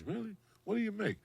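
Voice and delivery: high, feigned amazement; mock surprise. Comic asking the woman what she earns, feigning high surprise. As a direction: act fake-impressed.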